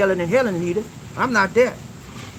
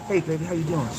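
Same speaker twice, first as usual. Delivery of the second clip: put-on lower, softer sweet hello to baby; sweet and sincere; 6 semitones lower, 6 dB quieter than usual. Shown as a demonstration. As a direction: greet her like you truly mean it. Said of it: "Sweet, and be sincere about it".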